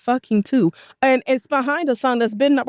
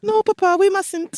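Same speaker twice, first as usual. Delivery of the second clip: higher, laughing mock French accent; a kid pleading in French accent; 9 semitones higher than usual. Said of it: "his little French accent".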